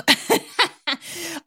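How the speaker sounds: quick bright laugh; a short amused laugh; high laugh. Real, not acted. Laughs at own demo.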